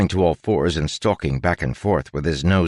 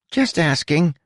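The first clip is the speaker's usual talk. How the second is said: rat voice; 11 semitones higher than usual. A narrator's reading. Huck the rat speaking.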